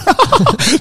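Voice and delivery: quick high giggle; short high laugh. Real, not acted. Telling a friend the tyre lost air again, giggling. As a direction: break into a brief laugh.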